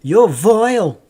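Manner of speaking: mock Northern English scolding. Streamer imitating a Northern English relative. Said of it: "especially when they say".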